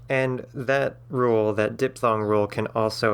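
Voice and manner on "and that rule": ordinary talk, nothing marked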